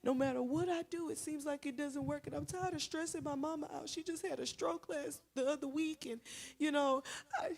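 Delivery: high mock-tearful lament; imitated crying. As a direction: pour it out like a crying boy. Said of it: "he's on the ground crying".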